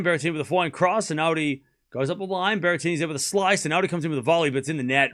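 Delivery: quick running rally commentary; live play-by-play. Acted. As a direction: call each shot as it happens.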